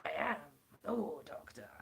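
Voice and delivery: low-starting, halting War Doctor voice; John Hurt. Acted. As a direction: attempt the War Doctor, starting deep in the throat. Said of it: "doesn't sound anything like John Hurt".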